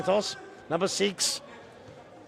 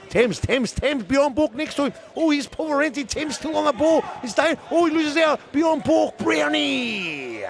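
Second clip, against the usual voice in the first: building excitement; 9 semitones higher than usual. Real, not acted. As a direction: pitch climbing higher, ending in shouts. Building play-by-play as attack develops.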